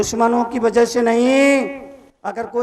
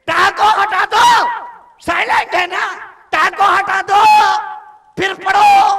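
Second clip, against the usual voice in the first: shouted command; 8 semitones higher, 6 dB louder than usual. Acted. Monk shouting at the crowd to drop the letter. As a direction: order them to drop it.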